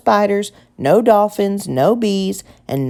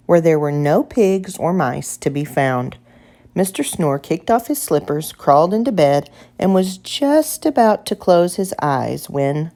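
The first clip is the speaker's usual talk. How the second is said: usual speaking voice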